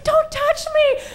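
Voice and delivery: high, slow whimpering plea; pained plea. Retold or imitated. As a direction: beg them off in a high strained voice. Shoulder hurting at the party.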